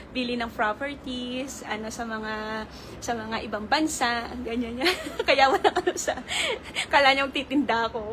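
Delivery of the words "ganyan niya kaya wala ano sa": ordinary talk, nothing marked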